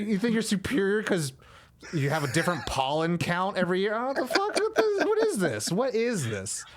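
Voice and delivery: mock outrage at the whole idea; incredulous. Incredulous rant.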